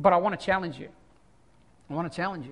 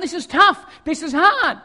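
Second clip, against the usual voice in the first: louder, higher mock complaint; grumbling disciples; 3 semitones higher, 5 dB louder than usual. Disciples complaining about hard teaching, imitated grumbling. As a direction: complain like put-upon followers. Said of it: "they're grumbling and complaining about what he's saying".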